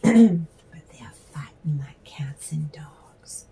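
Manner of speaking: slow, breathy whisper as if sharing a secret; whispered aside. Real, not acted. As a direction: drop to a whisper, slowly.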